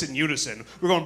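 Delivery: usual speaking voice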